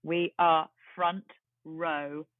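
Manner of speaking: each word drawn out slowly; slow savoring. Real, not acted. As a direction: let the news sink in slowly. Savouring amazing seats.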